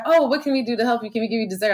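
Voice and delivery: lightly voiced restaurant lady offering dessert; customer service lady. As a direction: play the sugary helpful restaurant clerk. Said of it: "And the lady's like".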